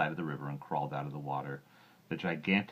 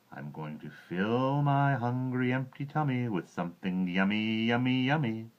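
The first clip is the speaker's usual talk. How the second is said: slow, higher, gloating singsong; greedy croc; 6 semitones higher, 3 dB louder than usual. Acted. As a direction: savour each yummy. Croc gloating over food.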